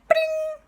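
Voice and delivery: very high, loud imitated ring; shrill mimicked ringtone; phone ring. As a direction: trill it like a telephone.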